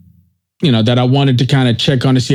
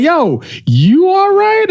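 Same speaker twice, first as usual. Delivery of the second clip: much higher, slow and drawn-out question; friend asking the right way; 13 semitones higher, slower than usual. Retold or imitated. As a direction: stretch the question out as the caring friend. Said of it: "He was like".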